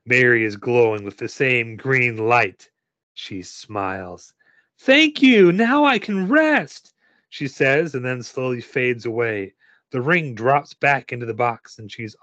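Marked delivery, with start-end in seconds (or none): 4.8-6.9 put-on high, drawn-out child voice; grateful ghost girl. A narrator's reading. Ghost girl thanking helper before fading away, high child voice.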